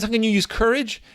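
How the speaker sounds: ordinary talk, nothing marked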